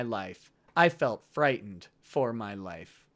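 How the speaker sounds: low, measured audiobook read; deliberate, solemn narrator; story narration. A narrator's reading. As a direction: read it out gravely and evenly. Reads book aloud.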